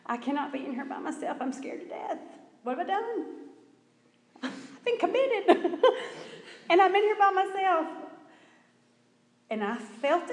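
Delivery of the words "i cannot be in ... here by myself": hammed-up panic, higher, breaking into laughs; retold panic climbing high and loud; panicked out loud. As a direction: wail it in mock terror, half laughing. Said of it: "I just said out loud".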